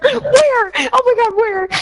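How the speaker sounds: thrilled at the thought of animals; eager squeal. Retold or imitated. Retelling her joke in the car, high excited squeal. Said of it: "I was like".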